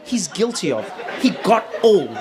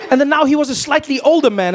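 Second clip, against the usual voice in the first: ordinary delivery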